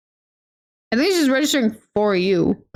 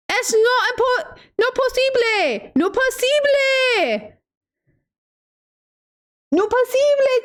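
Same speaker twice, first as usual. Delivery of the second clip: mock shocked Spanish; 10 semitones higher than usual. She mocks her own discovery, high and stretched. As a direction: squeal it in playful disbelief.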